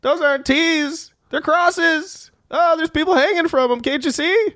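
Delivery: slow, high-pitched put-down from a street trader; exasperated rock merchant. Acted. Rock merchant scolds two ignorant strangers, exasperated and high-pitched.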